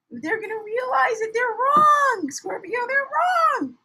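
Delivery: shouted verdict with wrong stressed; emphatic shout. Real, not acted. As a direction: drive it home that they're wrong.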